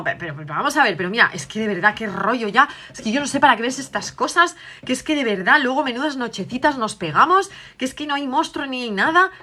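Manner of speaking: exasperated mother. Acted. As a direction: let her patience run out. Madre exasperada regaña a la hija. (la madre harta regaña a su hija, voz más aguda y fuerte)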